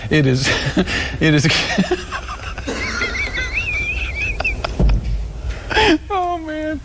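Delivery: breaking up laughing; helpless giggles. Real, not acted.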